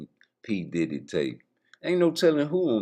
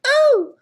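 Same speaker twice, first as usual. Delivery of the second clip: sudden high loud oh; high yelp; 14 dB louder than usual. Retold or imitated.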